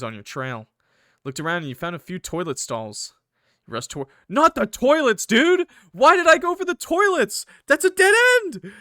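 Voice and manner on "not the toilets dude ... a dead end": high, loud outburst of disbelief; real high-pitched shouted protest; exasperated yelling. Real, not acted. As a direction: yell in dismay at a stupid move. Reacts aghast to story's dead end.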